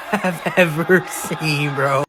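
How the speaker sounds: ordinary delivery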